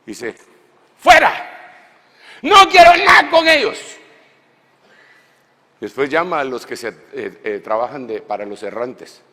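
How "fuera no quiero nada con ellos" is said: very high, loud shout of rejection; God shouting. Acted. Preacher voicing God driving Israel away, shouting high. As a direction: bellow God's rejection.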